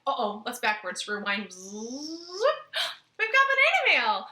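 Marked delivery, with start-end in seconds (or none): 1.8-2.9 surprised gasp. Acted. Surprise at banana mail. 3.2-4.3 high, loud excited call. Acted. Excited kids' show announcement.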